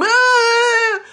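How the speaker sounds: long, high, loud demonstrated scream. Retold or imitated. Girlfriend screaming so her exes will beg, full scream. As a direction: let out a piercing, held mock scream. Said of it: "When I scream".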